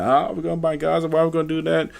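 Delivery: high, fast mock panic; hammed-up high panicky voice; mock panicked public. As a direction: squeal it like the alarmed public overreacting. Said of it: "They're like".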